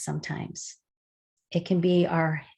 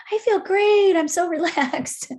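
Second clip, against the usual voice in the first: much higher and louder, laughing and bubbly; imitating cheerful kids; 10 semitones higher, 6 dB louder than usual. Teacher mimicking the relaxed kids after body work, high and giggly. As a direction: squeal it like happy, relaxed kids. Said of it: "everybody's like".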